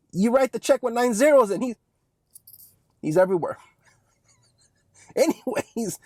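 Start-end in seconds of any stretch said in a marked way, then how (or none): 4.3-6.0 whispered laugh into a soft word; breathy laugh. Real, not acted. Laughing after boogeyman joke.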